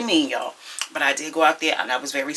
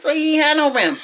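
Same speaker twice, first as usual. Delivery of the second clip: high, disbelieving question; incredulous question; 12 semitones higher, 6 dB louder than usual. Retold or imitated. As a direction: ask in sudden disbelief. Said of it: "I said".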